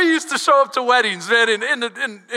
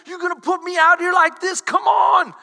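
mock protest; 7 semitones higher than usual. Jesus protests to mother.

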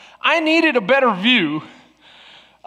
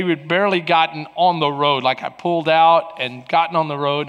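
ordinary talk

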